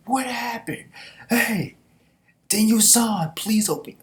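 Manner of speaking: high, pleading voice calling a fallen comrade; mock anime plea, high and urgent; pleading anime character. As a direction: beg him to open his eyes, pitched high.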